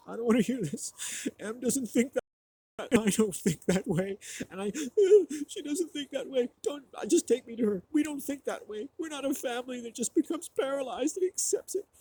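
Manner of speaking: high, loud, frantic voice of a mother in denial; shrill refusal, like a mom who won't accept it; upset mother. Retold or imitated. Mom refuses the doctor's news.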